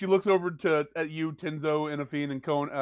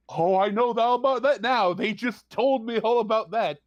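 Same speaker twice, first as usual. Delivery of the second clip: higher and louder, fake bright dismissal; mock cheerful NPC; 7 semitones higher, 5 dB louder than usual. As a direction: brush them off with sarcastic cheer. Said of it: "She goes".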